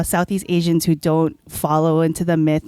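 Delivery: ordinary delivery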